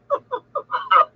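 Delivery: high-pitched burst of laughter; helpless high laughter at the joke. Real, not acted. Laughing at her own story.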